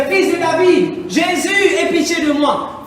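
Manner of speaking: high, loud pleading cry for mercy; acted beggar crying out for mercy; blind man's cry. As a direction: call out begging Jesus for pity. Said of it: "Et il commence à crier".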